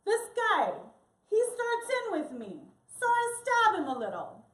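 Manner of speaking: lightly voiced animated raconteur; animated storyteller. Acts Ovidio's boastful bar tale.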